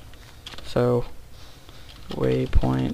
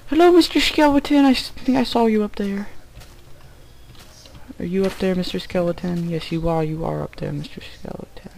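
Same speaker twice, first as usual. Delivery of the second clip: put-on teasing baby talk; 6 semitones higher than usual. Cooing at a skeleton mob.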